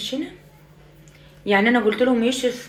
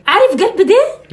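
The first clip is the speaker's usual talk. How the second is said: high, slow doting question; mother teasing her son lovingly; 9 semitones higher, 5 dB louder, slower than usual. Acted. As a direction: coo it at him.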